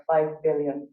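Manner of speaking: usual speaking voice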